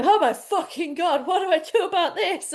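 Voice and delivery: panicked new mum. Retold or imitated. Imagining herself on Instagram asking followers, laughing mock panic. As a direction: play the flustered new mother. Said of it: "And I'll be going".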